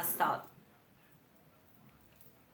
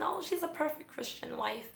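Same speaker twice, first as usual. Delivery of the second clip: higher, sugary parody of church praise; mock church approval; 8 semitones higher, 6 dB quieter than usual. The church praising the docile wife, in a cooing mock voice. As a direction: coo it like a smug churchgoer.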